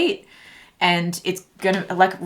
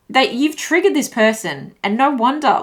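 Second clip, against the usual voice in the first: usual speaking voice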